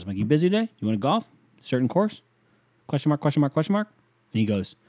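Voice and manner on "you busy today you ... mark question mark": rising tune on each question; rapid questions. Retold or imitated. Texting his cousin three questions, questioning lilt. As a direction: end "today", "golf", "course" on an upward lift. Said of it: "Hit him with, like, three questions".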